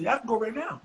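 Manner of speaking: high, eager reply; keen to get to the audition; eager yes. Retold or imitated. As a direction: jump at the chance.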